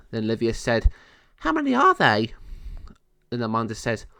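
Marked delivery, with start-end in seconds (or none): 1.4-2.3 fairy voice. Acted. Fairy Livia asks a question.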